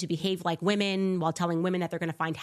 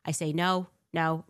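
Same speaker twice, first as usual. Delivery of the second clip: ordinary talk, nothing marked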